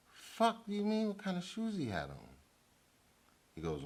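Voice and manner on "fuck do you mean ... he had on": much higher, disbelieving outburst; retold stunned reply to the cop's odd question; incredulous retort. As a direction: throw the question back in disbelief. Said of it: "I'm like".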